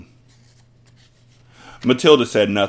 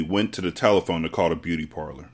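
usual speaking voice

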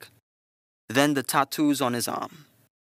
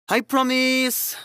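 excited shout; 12 semitones higher, 6 dB louder, slower than usual. Acted. Playful promise to child.

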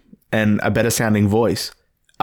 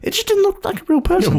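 high emphatic. Real, not acted. Emphatic repeat.

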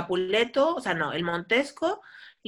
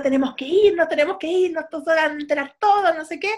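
a hint of a panicking friar, higher; hurried friar urging to flee; panicky friar; 6 semitones higher, 4 dB louder than usual. Friar panics to Julieta, lightly voiced. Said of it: "Le dice a la Julieta".

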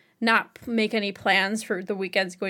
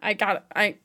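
usual speaking voice

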